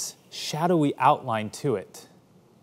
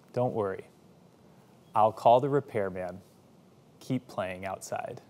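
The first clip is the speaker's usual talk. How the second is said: calm reassurance; 5 semitones lower, 5 dB quieter than usual. Retold or imitated. Mother calmly forgives broken window.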